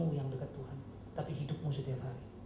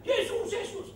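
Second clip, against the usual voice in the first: mock frantic cry; 4 dB louder, slower than usual. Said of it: "saya berdoa teriak".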